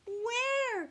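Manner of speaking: high loud cry. Acted. Katy crying out to the sleepy owl. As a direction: cry out desperately. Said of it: "cried Katy".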